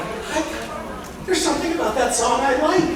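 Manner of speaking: puzzled, like half recognizing a tune; puzzled surprise. Retold or imitated. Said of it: "I'm like".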